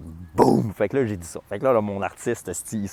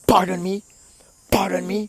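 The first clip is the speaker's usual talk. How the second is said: high, indignant mock pardon me; offended pardon me; 9 semitones higher, 5 dB louder than usual. Electrician answering the manager, indignant mock. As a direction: play the outraged diva.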